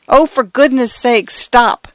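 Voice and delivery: high, frantic plea; begging the water to stop; pleading cry. A narrator's reading. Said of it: "cried Mister Fotheringay".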